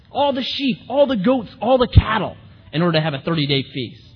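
high, fast, panicked protest; exasperated Moses. Acted. Moses pleading with God over the meat, high and rushed. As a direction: Moses rattling off flocks in disbelief.

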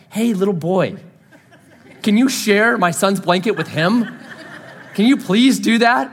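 sweetly plead, cracking up; mock polite mom. Other mom asking sweetly.

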